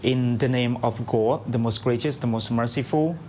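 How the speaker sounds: measured, solemn opening recitation; formal invocation. Acted. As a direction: recite the blessing steadily. Opens radio program with Islamic invocation.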